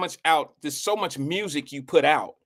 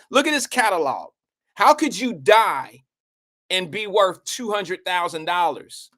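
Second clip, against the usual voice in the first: exclaiming; 4 semitones higher than usual. Real, not acted. Outraged about [name]'s money.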